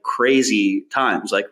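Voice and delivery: ordinary talk, nothing marked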